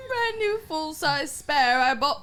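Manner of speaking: sing-song Irish through giggles; mock Irish accent. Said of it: "I can't do an Irish accent".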